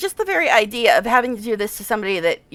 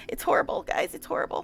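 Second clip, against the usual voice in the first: whispered and much softer, almost no voice; despairing whisper; 4 semitones higher, 8 dB quieter than usual. Real, not acted. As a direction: whisper it like a tired confession. Laughing despair over the scene.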